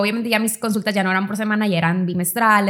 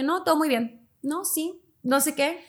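higher, softer, falsely upbeat denial; mock "I'm fine"; 4 semitones higher, 7 dB quieter than usual. To her psychologist, pretending everything is fine, higher and softer. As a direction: brush it off as if all is well.

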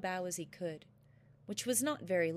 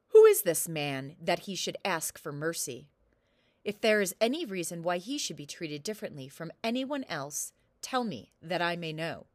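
loud, angry booming king; a ruler outraged at the request; 2 semitones lower, 9 dB louder than usual. Acted.

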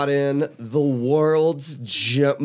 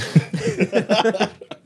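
bursting out laughing; real laughter at the punchline; big laugh; 7 semitones higher than usual. Real, not acted. Laughs at brother story.

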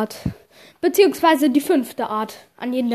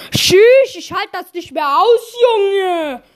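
exasperated; 5 semitones higher, 4 dB louder, much slower than usual. Acted. Teacher storms out exasperated.

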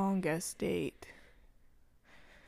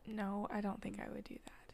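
whispering; 16 dB quieter than usual. Real, not acted. Quiet refusal.

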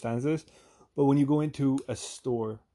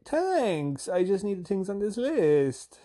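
high, slow singsong; singsong reply; 8 semitones higher, much slower than usual. Retold or imitated. Customer answering the flirting shop lady, in singsong. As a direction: sing it back sweetly, stretching the words. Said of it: "I have to sing it back to 'em".

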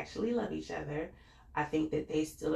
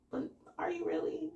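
skeptical mock question; 13 semitones higher than usual. Vlogger questioning Karen's sincerity, much higher sly doubt. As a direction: raise a sly eyebrow in the voice. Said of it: "she does stuff that's like".

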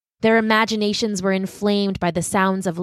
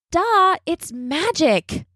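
slow, higher smug know-it-all drawl on "Duh"; a sister smugly pointing out the obvious; 9 semitones higher, much slower than usual. Acted. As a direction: tease her brother for stating the obvious. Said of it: "feeling very smug about it".

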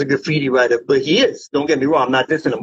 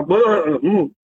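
usual speaking voice

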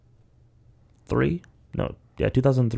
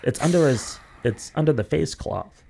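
higher, quick questioning voices; nurse and family; 8 semitones higher than usual. Retold or imitated. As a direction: voice the worried exchange, pitched up. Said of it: "the woman's like".

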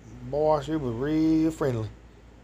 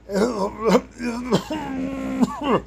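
coughs; 9 semitones higher than usual. Real, not acted. Coughing fit mid-story.

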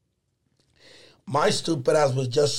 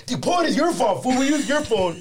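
joking friend; 6 semitones higher than usual. Retold or imitated. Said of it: "the only one that's joking".

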